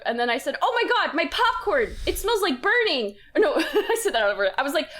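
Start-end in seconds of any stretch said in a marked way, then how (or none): 0.6-3.2 high, loud "oh my God"; panicked yelp at burning popcorn; alarmed cry. Retold or imitated. Retelling to friends her alarmed shout over burnt popcorn.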